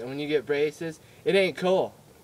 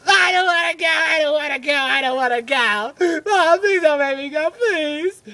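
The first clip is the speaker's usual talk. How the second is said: put-on loud, high wailing kid; over-the-top tearful pleading; hammed-up tantrum; 12 semitones higher, 9 dB louder than usual. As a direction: wail and beg like a child in meltdown. Tantrum begging mom not to go.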